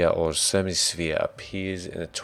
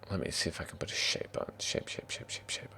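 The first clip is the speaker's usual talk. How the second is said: quick whispered muttering to self; thinking out loud; 12 dB quieter, faster than usual. Real, not acted. Game master hunts for a map shape, muttering. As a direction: mutter under your breath while working. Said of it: "thinks out loud".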